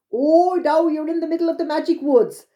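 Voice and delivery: a hint of a sprightly leprechaun; little man voice. A narrator's reading. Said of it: "the little man replied".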